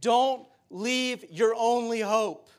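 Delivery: slow, emphatic warning; urgent plea. Retold or imitated. Preacher voices Paul begging his people, slow and urgent. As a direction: plead slowly, spacing out the words. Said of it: "he is begging them".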